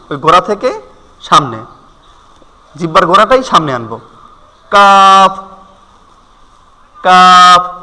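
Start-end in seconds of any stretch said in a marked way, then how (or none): 4.7-7.8 kaf said louder from the tongue front; letter demo. Shown as a demonstration. Teacher sounds out কাফ for the class, louder. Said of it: "একটু try করেন".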